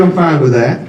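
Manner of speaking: ordinary delivery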